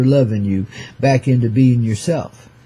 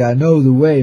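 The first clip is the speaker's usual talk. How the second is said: usual speaking voice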